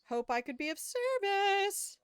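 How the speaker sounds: very high, loud sing-out; chirpy game character. Acted. Game character signing off to a customer, high cartoonish voice.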